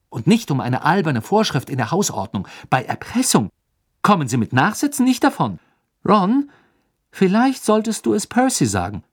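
put-on high, loud anxious girl; worried Hermine. A narrator's reading. Hermine urges Ron to tell Percy, anxious and loud. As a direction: press the point with mounting worry.

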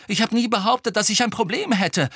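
high, indignant protest; heated retort. A narrator's reading. Ron defending himself to Harry, heated. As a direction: answer back hotly. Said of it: "erwiderte Ron hitzig".

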